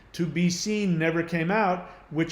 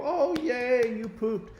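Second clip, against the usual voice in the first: put-on high, sing-song mom cooing at baby; doting mom; 7 semitones higher, slower than usual. Mom praising the baby for pooping, high doting coo. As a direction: coo it like a proud mom, pitched up. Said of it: "they go".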